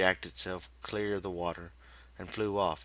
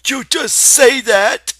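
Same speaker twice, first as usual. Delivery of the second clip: very high, much louder, slow and sharp; sharp scolding aunt; 22 dB louder, slower than usual. A narrator's reading. Aunt Clara snaps at Lenny, very high and much louder. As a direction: cut him off with contempt. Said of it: "she said sharply".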